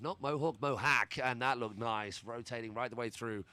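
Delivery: pumped-up play-by-play call; tractor pull announcer. Acted. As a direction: call every trick like a monster truck rally. Hyped live trick play-by-play.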